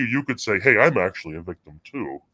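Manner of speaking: ordinary delivery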